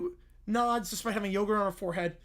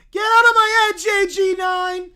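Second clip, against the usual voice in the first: put-on much higher, very loud child's shriek; children shrieking; 8 semitones higher, 12 dB louder than usual. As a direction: shriek it like frightened kids. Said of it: "The three children shriek".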